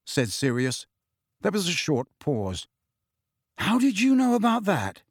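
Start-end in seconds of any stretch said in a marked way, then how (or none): 3.6-5.0 higher-pitched demanding question. A narrator's reading. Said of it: "Harry demanded".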